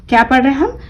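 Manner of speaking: ordinary delivery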